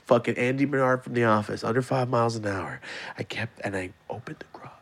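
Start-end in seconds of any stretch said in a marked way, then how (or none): 3.2-4.7 unvoiced creeping whisper; whispering the sneak into the garage. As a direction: hush it like sneaking in. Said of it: "So I crept".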